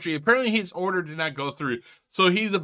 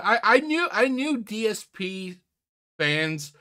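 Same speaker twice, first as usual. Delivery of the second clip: usual speaking voice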